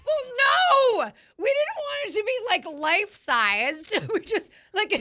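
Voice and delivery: very high, loud outburst; shrieked objection, close to laughter; loud amused protest. Real, not acted. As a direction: cry out in real high-pitched protest. Laughing protest at overdone emoji imitation.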